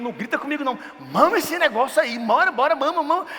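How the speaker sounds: high-pitched, panicky urging; frantic father. Retold or imitated. Frazzled father urging crying baby.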